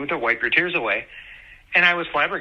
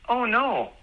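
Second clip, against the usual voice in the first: high-pitched imitation of a toddler; 9 semitones higher than usual. Dad retelling his toddler's reaction to mom crying, imitated toddler voice. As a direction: do a little toddler's voice, pitched well up. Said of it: "in that toddler voice".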